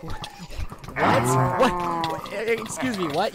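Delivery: stunned repeated what, half-acted; retold disbelief. Retelling his stunned reply to Karen's accusation, mock disbelief. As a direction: replay your own shocked reaction. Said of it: "I'm like".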